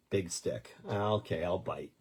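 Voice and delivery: ordinary talk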